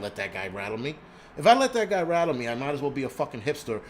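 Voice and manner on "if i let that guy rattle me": the same line again, much louder and higher; louder, higher outburst. Real, not acted. Ranting vlogger to viewers, erupting louder and higher. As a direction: let it burst out, louder.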